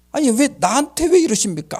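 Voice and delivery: imitated loud, high protest; arguing back. Shipwrecked captain demanding God explain, loud protest. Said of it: "하고 따지는 거야".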